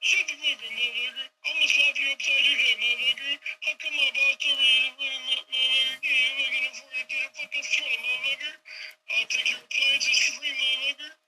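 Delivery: high, ranting mock plumber voice; crazy plumber Phil. Phil the plumber yelling back at the customer, high hammed-up rant. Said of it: "Phil's like".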